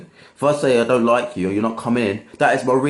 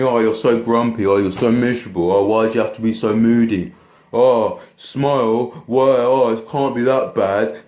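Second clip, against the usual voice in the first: mocking whine; 3 semitones lower than usual. Security guard mimicking people calling him grumpy, mild mocking whine. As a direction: mimic the people who nag you to cheer up, a bit sneering. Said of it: "some people say to me".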